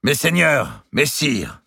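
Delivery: the regent raises his voice over the hall; Poitiers calling the lords. A narrator's reading. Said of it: "dit le comte de Poitiers".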